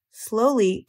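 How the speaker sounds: plain reading voice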